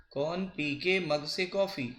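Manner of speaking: slow, deliberate repeat of the trick; slow mnemonic recital. Shown as a demonstration. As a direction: drill the line for students. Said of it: "example याद करने का technique है".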